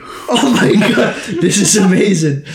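high, loud, slowed wonder with a laugh; amazed barkeep. Acted. Barkeep amazed the stranger suddenly speaks his language, high and laughing. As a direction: marvel out loud, delighted.

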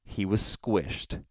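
slow, drawn-out delivery; deliberate statement. Shown as a demonstration. Prankster quoting his customary answer, slow and deliberate. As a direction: state it slowly in your own register. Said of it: "I say".

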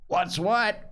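usual speaking voice

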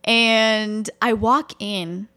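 drawn out like a movie scene; slow dramatic. Shown as a demonstration. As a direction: stretch it out, cinematic entrance. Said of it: "just try to picture it as a movie".

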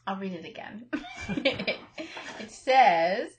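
giggling through the words; breaking up with laughter; laughing. Real, not acted. Rereading after giggling fit.

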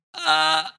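high-pitched "ah" of pain; pain sound. Shown as a demonstration. Shows friends own reaction to pain, high groan. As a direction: demonstrate a short, high pain noise. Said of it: "ik ga meestal, doe ik gewoon".

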